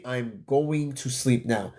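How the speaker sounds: slow, quiet sleepy drawl. Real, not acted. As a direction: drag it out, half asleep. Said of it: "I'm beat".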